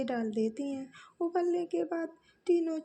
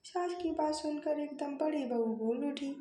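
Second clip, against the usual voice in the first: ordinary delivery, nothing marked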